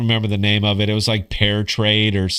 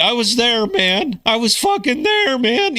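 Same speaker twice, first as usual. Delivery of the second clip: high, cracking mock war-vet voice; 11 semitones higher than usual. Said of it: "You like, you like the voice crack?".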